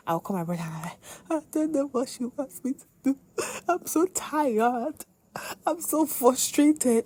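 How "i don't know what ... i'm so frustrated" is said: high, slow, drawn-out wail of frustration; imitating her own tearful frustrated self; distraught complaint. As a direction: whine it to your brother like you're at the end. Crying call to brother, retold.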